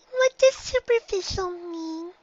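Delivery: high little filly voice; a small girl pressing her mother; high-pitched child pony insisting; young filly. A narrator's reading.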